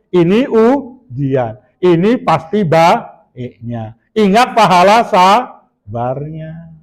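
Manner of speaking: very slow, each phrase set apart; slow calm self-reminder. Shown as a demonstration. Preacher showing the congregation slow, patient self-talk.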